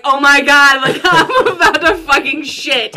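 loud mock panic breaking into laughter; frantic kid. Storyteller reliving the wait at the locked bathroom door, frantic. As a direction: laugh through a hammed-up desperate plea. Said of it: "literally like a kid jumping".

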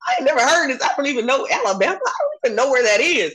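mock outrage at a school nobody's heard of; incredulous disbelief. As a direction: tell them you've never even heard of the place. Retold reaction to Alabama school.

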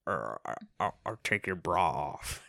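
mock low, hushed seduction attempt; awkward dirty talk. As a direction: stammer a come-on in an imitated deep, quiet voice. Imitating awkward CB sex talk.

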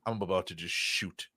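quiet bottled-up fury; seething quietly. Real, not acted. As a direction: grumble it under your breath, quietly furious.

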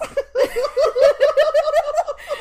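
loud high burst of laughter. Real, not acted. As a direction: break into a big real laugh. Reacts to shocking story.

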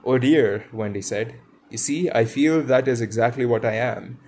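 storybook reading voice, lively dialogue; story narration. Acted. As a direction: read it like a bedtime story to listeners. Reads Peter Pan aloud.

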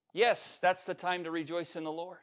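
ordinary talk

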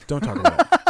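sudden high, loud outcry; loud protest. Real, not acted. Cringing at the co-host, a high loud protest. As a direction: cut him off with a loud yelp. Co-host talking about Meryl Streep.

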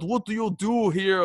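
put-on Swedish accent. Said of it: "I don't know how to do a Swedish accent".

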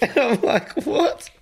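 high, laughing what; giggling incredulous what; laughing disbelief. Retold or imitated. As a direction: crack up in shock.

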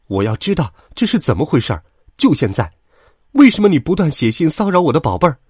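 rapid, rising angry interrogation; agitated. A narrator's reading. Sheep mother confronts the wolf about the letters, agitated. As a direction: demand answers fast, building to loud and high. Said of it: "羊妈妈也安静不下来".

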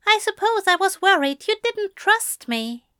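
high-pitched angel character voice; Aziraphale, sheepish. A narrator's reading. Blushing angel confessing his worry to Crowley, sheepish.

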